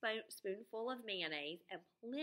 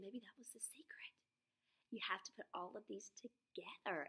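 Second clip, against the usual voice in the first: hushed unvoiced whisper; 8 dB quieter than usual. Real, not acted. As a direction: whisper it like a secret plan. Teacher shares the character's secret idea.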